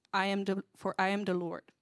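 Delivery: usual speaking voice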